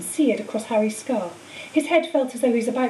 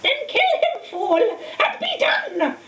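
much higher, slowing furious screech; 11 semitones higher, much slower than usual. A narrator's reading. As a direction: screech the command in fury. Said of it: "screeched Voldemort".

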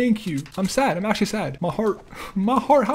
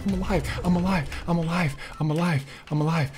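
low, soft repeated murmur; relieved muttering; 6 semitones lower, 10 dB quieter than usual. Real, not acted. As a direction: say it quietly to yourself. Barely survives, repeats to himself.

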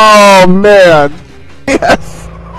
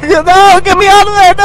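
panicked cry; 9 semitones higher than usual. Real, not acted. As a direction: yell for help in a panic.

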